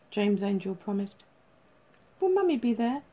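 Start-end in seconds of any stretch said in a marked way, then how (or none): 2.2-3.0 lightly voiced little boy asking; small boy. A narrator's reading. Six-year-old asks Dad about Mummy, childlike.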